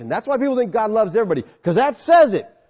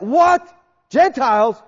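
high, loud, incredulous echo of each word; shocked disbelief; 8 semitones higher, 6 dB louder, slower than usual. Retold or imitated. Nicodemus hearing God loves Gentiles, aghast and incredulous. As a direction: repeat it back like you can't believe your ears.

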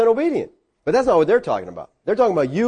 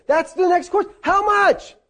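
pitched way up, pressing the point; emphatic high answer; 13 semitones higher than usual. Real, not acted. Preacher to his class, answering in a high voice. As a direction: leap high to stress the question.

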